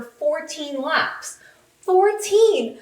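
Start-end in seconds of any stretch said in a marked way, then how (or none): 1.8-2.8 high, punched exclamation; amazed emphasis. Real, not acted. Podcaster marvelling at Carlos's fourteen laps led, high and amazed. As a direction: marvel at the number, pitched right up. Amazed repeat of the lap count.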